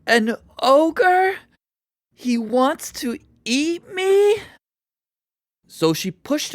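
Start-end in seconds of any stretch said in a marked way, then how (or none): 0.1-4.4 put-on high, slow, frightened boy's voice. A narrator's reading. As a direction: panic at the news. Jack hears of the ogre.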